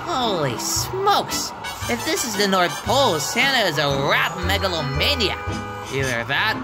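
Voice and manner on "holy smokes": slow, high-pitched awe; amazed exclamation. Acted. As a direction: draw it out in astonishment. Arriving at an astonishing place.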